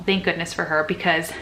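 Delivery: normal talking voice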